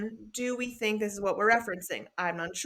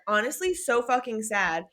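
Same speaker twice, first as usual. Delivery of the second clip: ordinary talk, nothing marked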